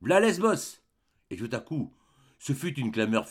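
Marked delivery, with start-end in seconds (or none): 0.0-0.8 crowd cry. Acted. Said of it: "Un cri partit de la grenouillère".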